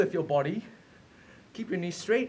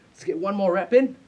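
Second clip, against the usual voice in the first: loud push; 7 dB louder than usual. Real, not acted. Coach pushing class hard.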